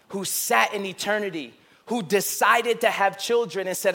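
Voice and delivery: usual speaking voice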